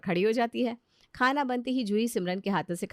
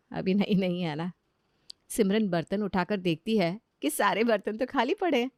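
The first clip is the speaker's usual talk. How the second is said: ordinary delivery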